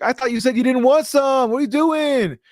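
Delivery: high, loud imitation of an indignant girlfriend; comic shrieked scolding; mock outraged yell. Acting out girlfriend catching fry theft.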